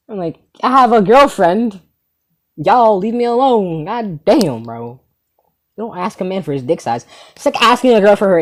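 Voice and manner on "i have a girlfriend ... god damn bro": slow, drawn-out, higher-pitched complaint; dragged-out whine, dropping off at the end; exasperated protest. Retold or imitated. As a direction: stretch each phrase, pleading to be left alone. Retold plea to be left alone.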